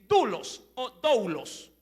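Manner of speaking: Dulos o doulos said slowly, both variants; slow, deliberate repetition of Dulos and doulos; teaching both ways to say doulos; Greek pronunciation. Shown as a demonstration. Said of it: "Se la podría pronunciar así".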